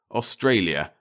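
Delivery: plain reading voice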